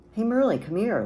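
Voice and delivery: ordinary delivery, nothing marked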